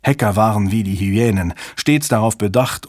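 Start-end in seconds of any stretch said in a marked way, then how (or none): none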